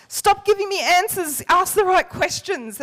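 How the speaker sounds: mock-rebellious protest to the nurses; mock defiance. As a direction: throw it back at the nurses playfully.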